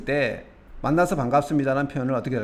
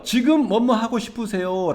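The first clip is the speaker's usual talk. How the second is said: higher, slow courteous question; polite inquiry; 7 semitones higher, slower than usual. Shown as a demonstration. Teacher modelling a question about someone's wishes, courteous. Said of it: "상대방에게 공손하게 의향을 물어볼 때는요".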